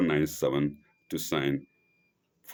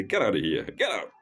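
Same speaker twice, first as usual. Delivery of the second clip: lightly voiced Englishman. Englishman telling someone to leave, mock brusque order. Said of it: "The Englishman will say".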